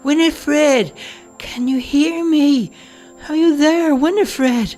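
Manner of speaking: put-on high old woman calling out loudly down the corridor; a frail mother calling for her daughter, loud and high. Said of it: "a weak voice kept calling out".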